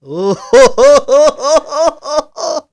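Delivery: big burst of real laughter; cracking up at a friend's impression; loud laugh. Real, not acted. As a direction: laugh out loud, high and hearty.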